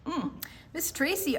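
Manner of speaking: ordinary talk, nothing marked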